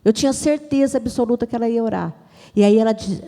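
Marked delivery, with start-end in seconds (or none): none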